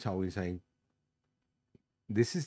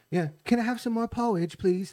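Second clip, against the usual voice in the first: mock pleading orphan; 10 semitones higher than usual. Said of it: "to be like".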